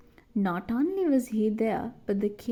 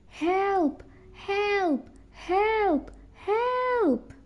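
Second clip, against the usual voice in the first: calling for help; 10 semitones higher, much slower than usual. A narrator's reading. Trapped girl calling for help.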